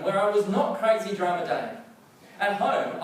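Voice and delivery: ordinary delivery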